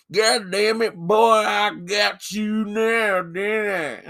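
high, loud, drawn-out mock drawl accent; hammed-up general in a different accent; sudden accent switch. Co-host mocking the general's wandering accent in a fight, loud exaggerated drawl. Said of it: "the general all of a sudden is like".

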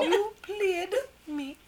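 slow, drawn-out fake British; mock British accent. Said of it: "British accent".

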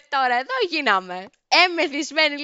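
loud, high, put-on dismay; acted panic. Drunk passenger seeing the sea approach, loud acted panic. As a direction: raise the voice in mock despair.

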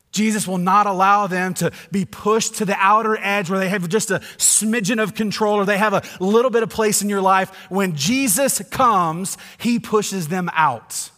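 loud, high proclamation; full-force preaching. Acted. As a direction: drive it home to the congregation.

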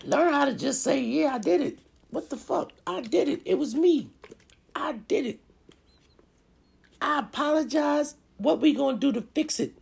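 very high, squeaky pleading confession. Acted. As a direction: own up in a thrown-on squeaky voice. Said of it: "I have to throw on my squeaky voice".

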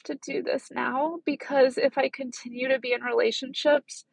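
fighting back real tears; voice breaking. Real, not acted. Telling viewers about her breakup, voice breaking with tears.

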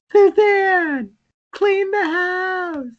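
put-on high, loud, slow nagging old mother; hammed-up shrill order from a frail mom; mock mom scolding. Friend imitates the mom ordering her daughter, high and loud.

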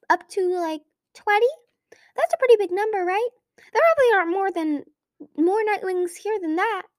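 very high dim-witted dragon voice; cheerful clueless dragon, pitched high; goofy high voice. A narrator's reading. Dim dragon voice through mirror.

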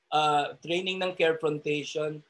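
normal talking voice

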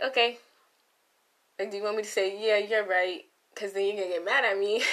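loud, exasperated mock reply. Answering someone who keeps calling themselves ugly, exasperated. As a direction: push back loudly, at a loss.

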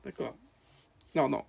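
ordinary talk, nothing marked

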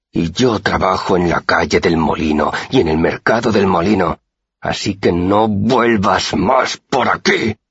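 entre dientes, subiendo y alargando el final despacio; through gritted teeth. A narrator's reading. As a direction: remarca cada palabra con amenaza. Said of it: "me decía entre dientes". (el guardia amenaza entre dientes al chico)

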